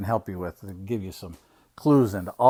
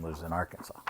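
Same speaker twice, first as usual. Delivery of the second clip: ordinary delivery, nothing marked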